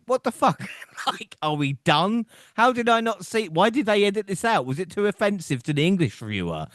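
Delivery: high, disbelieving rant; can't believe they cut it; incredulous. Real, not acted. Outraged at edited-out segment.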